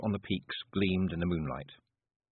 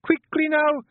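very high, urgent shout; 8 dB louder, slower than usual. A narrator's reading. Wife shouting down the hole for help, urgently. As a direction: shout down a hole to hurry them. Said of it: "Jeanie shouted down the hole".

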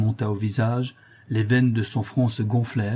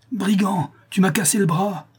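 much higher, agitated cry; pained outcry; 9 semitones higher than usual. A narrator's reading. Brigand clutching his broken arm, high outcry. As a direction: cry out as if just struck. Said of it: "s'écria-t-il".

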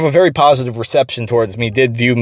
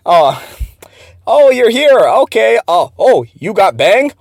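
high-pitched, louder imitation of his broken English; flustered mock accent, pitched up; mock boss accent; 8 semitones higher, 3 dB louder, slower than usual. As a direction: ham up the boss's halting accented greeting.

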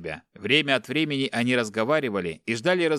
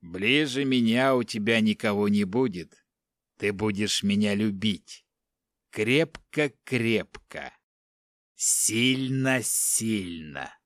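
menacing captor; slower than usual. Acted. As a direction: drawl each word like a creepy obsessed man. Captor speaks to bound family.

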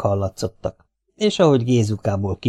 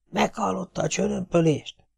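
hushed, soft warning; whispering; 8 semitones higher, 6 dB quieter than usual. Acted. Gézuka warns the others in a hush. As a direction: breathe the name out softly.

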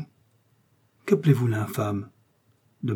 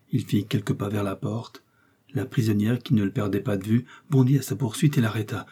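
ordinary delivery

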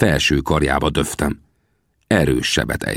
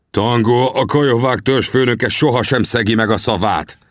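proud, raised-pitch chief's declaration; mildly lofty, self-important answer; haughty; 6 semitones higher than usual. A narrator's reading. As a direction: proclaim your honour from on high. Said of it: "felelte gőgösen".

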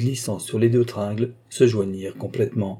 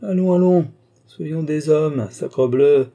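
high, loud rallying call; hearty burst of forced cheer; rousing shake-off; 6 semitones higher, 5 dB louder, slower than usual. Acted. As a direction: shake himself and the friend out of gloom. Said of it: "se secouant vigoureusement".

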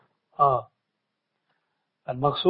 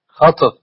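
snapped off short and loud; snatch the word off quickly; clipped short; 7 dB louder, slower than usual. Shown as a demonstration. Sheikh showing the clipped takbir, loud and short. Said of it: "جزم يعني خطف".

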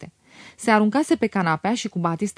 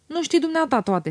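stubborn; 7 semitones higher than usual. A narrator's reading. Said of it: "se încăpățână ea".

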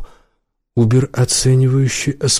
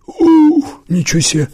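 a sudden high yelp of amazement; surprised exclamation; 3 dB louder than usual. Acted.

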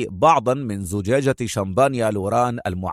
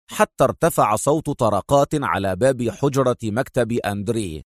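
plain reading voice

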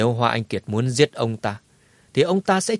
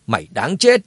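angry curse; 2 semitones higher, 5 dB louder than usual. Acted. Fighter avenges slain comrade.

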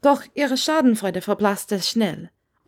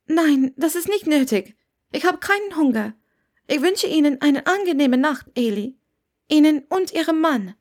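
lightly voiced young woman, higher, politely eager to leave; a higher, polite voice that wants out of the room; young woman Hermine; 7 semitones higher than usual. A narrator's reading. Hermine politely excuses herself to the landlady, lightly voiced higher.

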